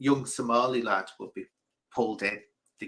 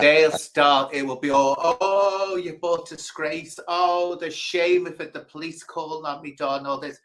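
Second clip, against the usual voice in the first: lightly voiced lamenting mum; nagging mother; 5 semitones higher, 5 dB louder than usual. Somali mother scolding her son, mock wailing nag. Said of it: "She said".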